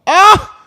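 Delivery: loud high yelp of shock. Retold or imitated. Finding out it was a rock, a loud high yelp. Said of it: "I'm like".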